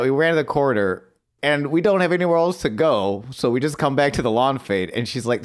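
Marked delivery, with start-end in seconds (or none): none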